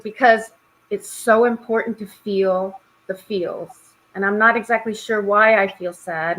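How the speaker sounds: ordinary talk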